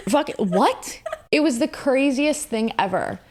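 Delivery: usual speaking voice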